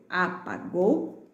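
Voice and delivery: "apagou" split a - pa - gou; slow separate beats on "apagou"; syllable by syllable. Shown as a demonstration. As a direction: sound out a, pa, gou. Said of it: "ficam na mesma sílaba".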